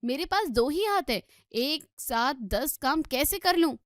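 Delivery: loud, harried grumbling about too much work; loud harried complaint about the files; exasperated complaint. Acted. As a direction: complain loudly, overwhelmed. Sister-in-law complaining, overloaded.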